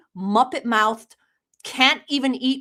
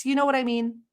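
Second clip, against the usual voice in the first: ordinary talk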